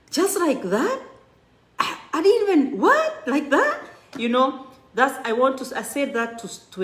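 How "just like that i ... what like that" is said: much higher, louder, the pitch leaps up in astonishment; astonished disbelief. Retold or imitated. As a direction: act out being stunned by the news. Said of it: "I was like".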